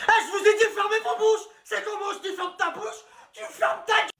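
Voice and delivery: very high, strained scream; hammed-up hysterical telling-off; teacher losing it. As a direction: screech at the pupils to shut up. Teacher explodes at class.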